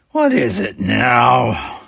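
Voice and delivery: slow, loud exasperated demand. A narrator's reading. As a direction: drag it out, fed up. Exasperated captain after a sigh.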